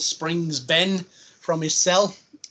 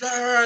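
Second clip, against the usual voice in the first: higher mock growling cry; imitated noise; 7 semitones higher than usual. Retelling the device demonstration on the rabbit, imitated noise. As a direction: act out the noise, pitched up.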